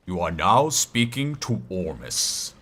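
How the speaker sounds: slow, low game narrator; mysterious NPC voice. Pretend. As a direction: announce it like an old sage. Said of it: "I'll do all the voices".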